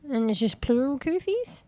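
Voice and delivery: ordinary talk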